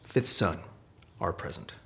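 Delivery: high, loud spoken-word delivery; poetry performance. Acted. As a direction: perform it like a corrido ballad. Performs poem at reading.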